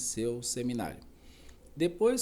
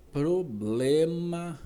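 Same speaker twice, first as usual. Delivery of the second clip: problema stretched slow and louder; stress on problema; 4 semitones higher, 5 dB louder, much slower than usual. Real, not acted. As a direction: lean hard on problema, drawn out. Lecturer emphasizes key word.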